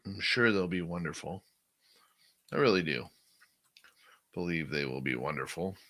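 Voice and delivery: dry sarcastic praise. Real, not acted. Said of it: "Please note the sarcasm".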